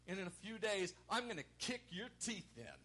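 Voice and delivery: high-pitched acted threat. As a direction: warn the enemy with intensity. Voices Jesus threatening the devil.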